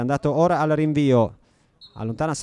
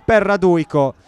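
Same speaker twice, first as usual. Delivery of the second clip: excited commentary; 4 semitones higher, 6 dB louder than usual. Real, not acted. Chance in front of goal.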